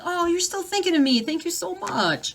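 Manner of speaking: high, sweet grateful thank-you; grateful friend. Retold or imitated. Friend touched by a late gift, sweetly grateful. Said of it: "they're like".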